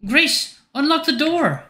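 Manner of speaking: very high-pitched character voice, demanding; worried, impatient Marion. Acted. Voicing Marion outside locked door.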